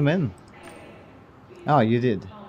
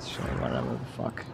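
annoyed; 12 semitones lower, 9 dB quieter than usual. Real, not acted. Annoyed mutter at game.

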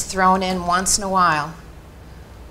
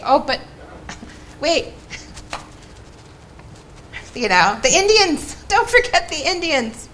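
higher, sing-song mock of tired pleading; 7 semitones higher than usual. Mimicking her own tired pleas at the legislature, in a higher, mocking voice. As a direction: parody the weary plea to lawmakers. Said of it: "it gets very tiring to say".

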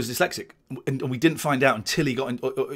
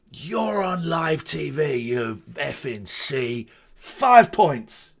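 put-on slow, drawn-out drunken taunt, building louder; badly imitated accent of a drunk bully; Brendan Burns; 3 semitones higher, 3 dB louder, much slower than usual. Drunk comic confronts him in the bar, imitated slow drunken jeer. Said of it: "drunk Brendan Burns came in".

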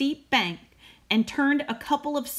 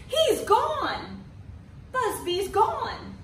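very high, slowing cry of dismay; dismayed exclamation; 12 semitones higher, much slower than usual. Acted. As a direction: parents shocked by the news. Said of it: "exclaimed all the fathers".

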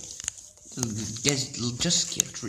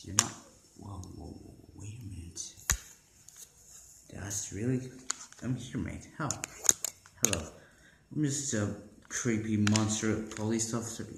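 lower, softer creepy cop voice; lightly voiced creepy monster cop; creepy police officer; 12 semitones lower, 9 dB quieter than usual.

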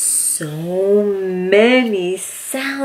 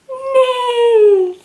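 high whinnying neigh; whinny like a horse; 3 dB louder than usual. Shown as a demonstration. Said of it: "Can you neigh like a horse".

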